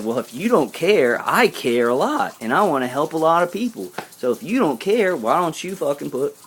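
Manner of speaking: heated, high-pitched replay of an argument; retold yelling. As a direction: act out telling someone off, pitch climbing. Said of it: "I was yelling at him today".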